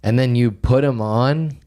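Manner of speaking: usual speaking voice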